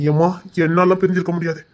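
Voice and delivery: usual speaking voice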